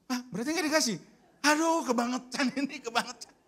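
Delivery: nearly shouting in disbelief; angry outburst. Retold or imitated. Marah ke istri soal uang. (marah-marah dengan suara tinggi)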